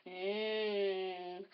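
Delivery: low, soft lip-licking hum; long low hungry hum. Acted. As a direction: relish a meal like a dingo. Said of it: "he's licking his chops".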